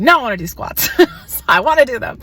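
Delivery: loud, high, defiant no; stubborn insistence. Retold or imitated. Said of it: "And I was like".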